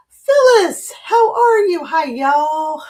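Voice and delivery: high, bright hello; excited high greeting. Real, not acted. Greeting viewer by name.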